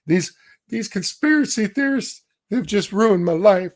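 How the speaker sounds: high, whining victim voice; mock self-pity, whine it; whiny mocking voice.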